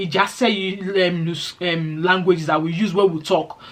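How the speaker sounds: normal talking voice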